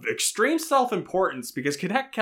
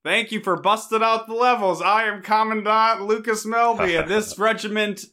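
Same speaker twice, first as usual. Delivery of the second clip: commandant voice; 7 semitones higher, 3 dB louder than usual. Acted. Commandant character intro.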